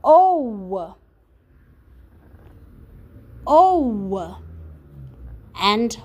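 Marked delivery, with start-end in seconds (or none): 0.0-4.4 "O" held as a long oh, louder; long O sound. Shown as a demonstration. Teacher repeating the oe sound aloud. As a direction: say "O" clearly for children to echo. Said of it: "also sounds like O".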